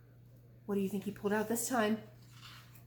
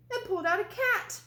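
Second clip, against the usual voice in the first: surprised reveal; 9 semitones higher, 3 dB louder than usual. A narrator's reading. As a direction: pitch jumps up higher on the reveal.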